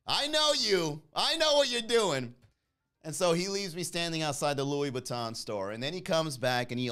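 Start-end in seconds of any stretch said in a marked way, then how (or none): 0.1-2.3 higher and louder, triumphant taunting; gleeful taunt. Retold or imitated. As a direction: rub it in, delighted. Confronting the doorman, teasing.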